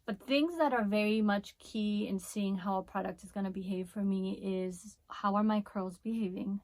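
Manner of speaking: usual speaking voice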